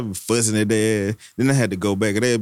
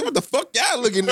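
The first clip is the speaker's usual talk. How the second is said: imitated cussing mother; 11 semitones higher than usual. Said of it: "she said".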